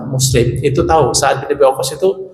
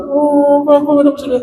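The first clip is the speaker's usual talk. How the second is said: higher, mock weepy old woman; old woman crying; 12 semitones higher than usual. Grandmother upset about heaven, mock weepy and higher. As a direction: wail it as a tearful grandma.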